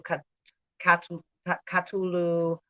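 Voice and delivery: Catulu sounded out as ka-TOO-loo; trying spellings aloud. Shown as a demonstration. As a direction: stumble toward Catulu. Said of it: "spelled in many other ways".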